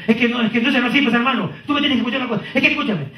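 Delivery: high, heated customer rant; an upset client shouting over you; mock yell, pitched well up; angry customer yelling. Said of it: "Está gritando, que hasta zapatea".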